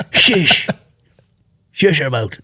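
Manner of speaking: mock McGregor. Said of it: "he just goes like".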